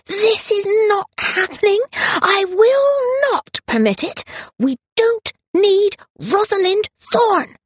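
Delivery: high-pitched outburst, drawn out word by word; slow, high, outraged cry; furious shout. A narrator's reading. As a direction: shriek in protest with fists balled. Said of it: "balled up her fists and shouted".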